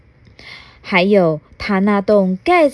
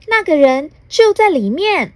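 higher fairy voice, a bit louder; a sprite revealing a secret; fairy pointing; 8 semitones higher, 4 dB louder than usual. Acted. Fairy shows the hole under the tree, high and lively. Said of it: "小精灵指着树下的小洞说".

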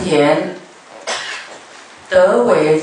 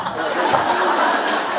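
soft breathy laugh; 3 semitones lower, 4 dB quieter than usual. Real, not acted. Laughs after retold line.

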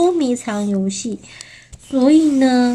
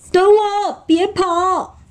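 high, urgent storybook call; pitched-up pursuer's cry; chasing wolf; 8 semitones higher, slower than usual. Acted. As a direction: call after the runaways in a raised voice. Chasing wolf calls after fleeing friends.

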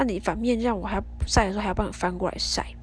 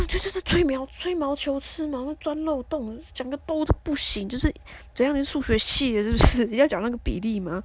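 sharply higher, angry comeback; heated retort; 5 semitones higher than usual. Retold or imitated. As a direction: fire back at him, annoyed. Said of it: "我那时候就很火". (对老公恼火地顶嘴)

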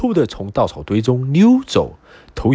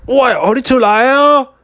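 high, loud furious shout; angry roar; 13 semitones higher, 4 dB louder than usual. Acted. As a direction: roar at the pig to come out. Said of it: "愤怒大声地咆哮".

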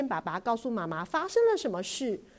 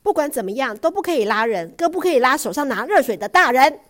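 loud, firm telling-off; a father scolding his daughter loudly; stern scolding; 6 semitones higher, 14 dB louder, faster than usual. Acted. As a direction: lay down the rule sharply.

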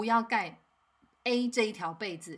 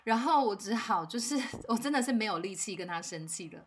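ordinary talk, nothing marked

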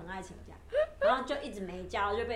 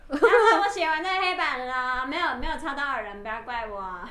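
loud announcement; 3 semitones higher, 8 dB louder than usual. Retold or imitated. Monitor at the blackboard to classmates, announcing loudly.

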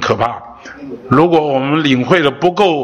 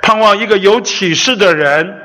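fervent preaching; 4 semitones higher than usual. Acted. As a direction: preach it with rising fire. Preacher builds to fervent climax.

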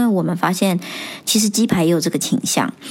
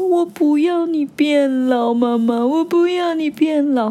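put-on higher, slow, tearful little-boy plea; crying child; 8 semitones higher, slower than usual. Son begging his mother not to grow old, imitated tearful plea. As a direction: beg like a sobbing little boy. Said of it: "他就开始哭".

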